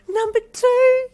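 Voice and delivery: squealed. A narrator's reading. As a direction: squeal with delight. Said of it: "you squealed".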